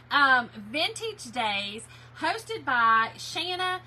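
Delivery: slow, careful announcing. Shown as a demonstration. Host introduces the event hosts, slow and careful. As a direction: spell out each name slowly. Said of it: "Let me slow it down".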